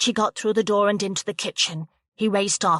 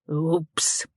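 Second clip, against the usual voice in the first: grinning oops; 3 semitones lower than usual. A narrator's reading. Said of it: "grinned Patrick".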